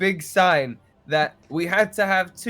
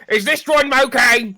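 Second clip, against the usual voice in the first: loud mocking offer; 8 semitones higher, 9 dB louder than usual. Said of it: "and goes".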